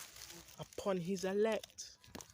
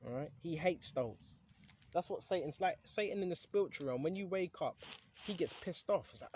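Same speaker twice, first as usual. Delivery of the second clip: ordinary delivery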